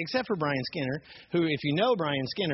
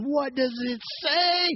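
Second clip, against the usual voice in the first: high, slow, stretched-out demand; imitated scream; 12 semitones higher, slower than usual. Angry stranger cusses and demands again, imitated screaming. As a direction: drag out each word in a mock scream. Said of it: "screaming at me".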